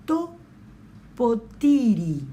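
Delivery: To potiri said slowly, each syllable clear; modelled Greek word. Shown as a demonstration. Teacher modelling Greek for class, slow and clear. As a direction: spell out To potiri for students to repeat. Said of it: "teacher models Greek word".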